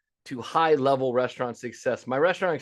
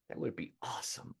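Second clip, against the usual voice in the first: whispered aside; 15 dB quieter than usual. Real, not acted. Aside after rhetorical question.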